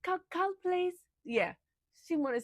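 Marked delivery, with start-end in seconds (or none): 0.0-0.9 squeaky little voice. Retold or imitated. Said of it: "he's like".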